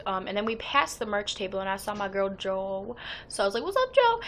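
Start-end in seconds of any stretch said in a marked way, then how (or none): 3.6-4.2 high, loud sing-out hello; squealed-up retold greeting; excited greeting. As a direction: call out to a friend, pitched way up. Acted greeting to her friend Jo.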